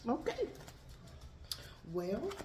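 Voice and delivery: usual speaking voice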